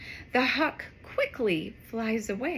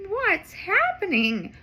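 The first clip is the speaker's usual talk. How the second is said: wondering cat; 6 semitones higher, 3 dB louder than usual. Acted. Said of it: "wonders the cat".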